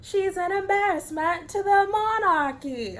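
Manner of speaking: high-pitched, badly faked British accent. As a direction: ham up a poor UK accent, way up high. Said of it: "that was really bad U K accent".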